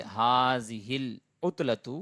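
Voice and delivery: ھذہ العطلۃ drawn out word by word; deliberate classroom demo; slow model sentence. Shown as a demonstration. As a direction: model the Arabic slowly for the class. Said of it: "آپ اور بول سکتے ہیں".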